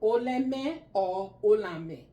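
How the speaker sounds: slow, clear Oleme then Olame; two Esan variants. Shown as a demonstration. Teacher showing the class two ways to say it, slow and clear. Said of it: "That's, that's a little accent in that".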